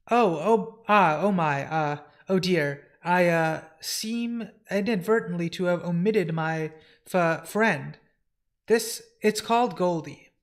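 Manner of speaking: halting, fussy oh-dear fluster; flustered stammerer. Acted. Housekeeper admitting he left out his friend, flustered. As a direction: stumble over the words, apologetic. Flustered character corrects an omission.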